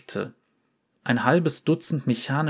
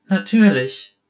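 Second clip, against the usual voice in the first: eifrige Zusage; 7 semitones higher, 6 dB louder than usual. Acted. Oriana antwortet dem Jungen.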